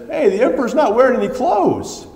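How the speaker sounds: high, piping mock child calling out; child's voice. A child points out the naked emperor, high piping voice. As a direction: pitch it up like a small kid blurting the truth. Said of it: "a child says".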